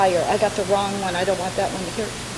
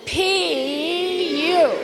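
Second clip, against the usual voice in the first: high, playful yuck; disgusted P U; 7 semitones higher than usual. Shown as a demonstration. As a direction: model it again for the audience. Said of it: "Give it a try".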